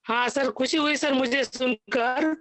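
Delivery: ordinary talk, nothing marked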